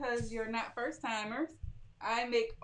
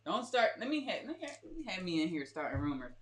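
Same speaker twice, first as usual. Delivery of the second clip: usual speaking voice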